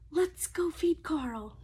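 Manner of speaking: slow inviting whisper. Acted. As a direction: whisper like a secret to the kids. Leads kids to fish.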